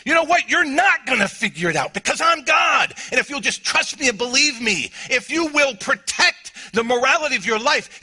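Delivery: high, loud proclamation; booming divine command; shouting as God. Acted. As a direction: thunder it out as God. God voiced in sermon.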